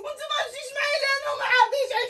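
high, sobbing outburst with broken laughs; crying shout. Acted. Sister wails at her brother that her friends are waiting. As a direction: wail it between sobs and shouts.

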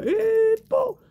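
long high squeal, then a thud; imitated catapult shot. Teller showing his catapult firing, imitated sound effect. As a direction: mimic a launch whistle and impact.

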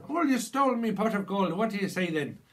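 hammed-up Irish accent, high and loud. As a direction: play a cheeky leprechaun in a mock Irish accent. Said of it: "[name], do an Irish accent".

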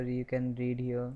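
ordinary talk, nothing marked

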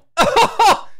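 very high, loud fake laugh; scornful burst of laughter; mocking cackle. Nintendo laughing at the pitch, loud hammed-up cackle.

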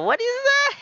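high, loud disbelieving question; shocked high-pitched what is that; baffled squeal. Real, not acted. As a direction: squeak it out in total confusion. Baffled by a strange word.

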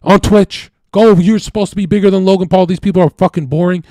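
loud, high furious shouting, barely holding back; furious yell. Retold or imitated. Twin brother throwing him out, furious loud yelling. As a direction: yell him out of the house for good. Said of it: "He's like".

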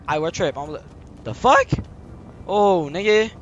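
ordinary talk

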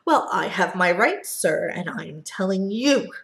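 loud, angry retort; Onceler yelling. Acted. Onceler yelling back at the Lorax, loud and angry. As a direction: shout him down in fury. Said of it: "I yelled at the Lorax".

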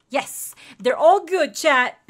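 loud, elated shout; high, loud cheer; triumphant announcement. Real, not acted. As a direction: shout the good news to the stream. Announcing success to viewers.

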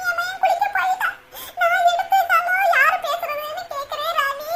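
high, soft weeping lament; plaintive, sobbing storytelling; tearful. Acted. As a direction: recount it through tears, voice climbing. Mother tearfully recounts the phone call.

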